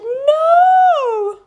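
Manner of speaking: high, loud cry of protest; shouted. Acted. Children protesting as pets are taken, loud shout. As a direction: yell it as a crowd of upset kids. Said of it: "All the girls and boys shouted".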